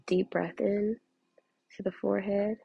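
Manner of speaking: quiet guided breathing with long holds; holding breath. Shown as a demonstration. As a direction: breathe in, hold, and breathe out softly. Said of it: "So I'm gonna do that really quick".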